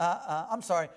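normal talking voice

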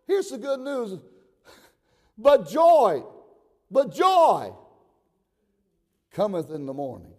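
high, loud pulpit shout; full-force preaching. Real, not acted. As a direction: thunder it out to the whole church.

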